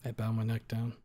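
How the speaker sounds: usual speaking voice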